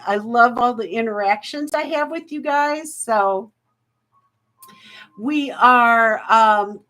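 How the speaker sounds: ordinary delivery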